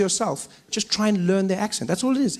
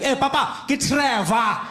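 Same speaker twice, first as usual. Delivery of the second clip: put-on higher-pitched, accented irritated correction; irritated friend; 6 semitones higher, much slower than usual. Irritated friend leaning over to correct, accented impatience. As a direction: lean in and correct him impatiently. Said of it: "my friend who was irritated".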